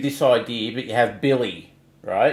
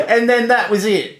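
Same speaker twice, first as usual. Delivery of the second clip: high laughing burst; real laughter, higher; 11 semitones higher, 6 dB louder than usual. Real, not acted. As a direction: crack up at the story. Laughing at the story.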